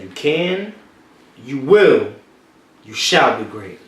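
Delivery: slow three-step build, you can, you will, you shall be great; emphatic declaration. Shown as a demonstration. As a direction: deliver it like a prophecy, hitting will. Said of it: "Say that one more time".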